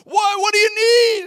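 high, loud hammed-up wail; mock urgent concern. Preacher asks the man at the altar, mock wailing. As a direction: imitate an over-earnest cry of concern.

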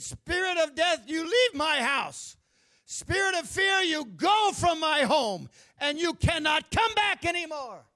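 high, loud commanding rebuke; commanding shout. Retold or imitated. Retelling a mother ordering death and fear from her home, shouted rebuke. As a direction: cast the spirits out with a ringing command.

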